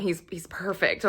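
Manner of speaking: soft swooning whisper. Real, not acted. To ladies and gentlemen, a lovestruck whisper. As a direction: hush it, lovestruck.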